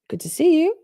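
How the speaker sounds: high, bright gasp of welcome; gasping delighted greeting. Real, not acted. Gasped greeting to Akasha.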